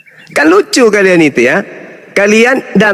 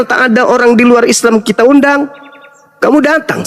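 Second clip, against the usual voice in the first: ordinary delivery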